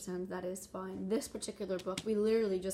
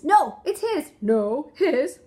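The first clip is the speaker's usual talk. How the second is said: loud, higher back-and-forth blaming; mock squabble; 6 semitones higher, 14 dB louder, much slower than usual. Animals blaming each other over the egg, mock squabble. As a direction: toss the blame like bickering kids.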